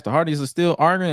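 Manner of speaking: ordinary talk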